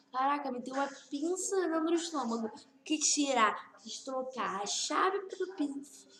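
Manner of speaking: loud, astonished exclaiming; excited outburst. Real, not acted. Gamer astonished at forceps in the stomach, loud. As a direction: blurt it out in loud disbelief.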